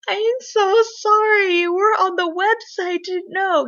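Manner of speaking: high, slow, drawn-out mock-wailed sorry; pleading apology. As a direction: overdo the apology in a retold plea. Acting out own flustered apology.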